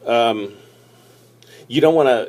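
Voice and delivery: ordinary delivery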